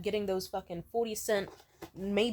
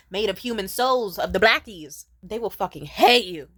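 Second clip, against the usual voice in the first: loud rant; 10 dB louder than usual. Real, not acted. Ranting about high-end staff.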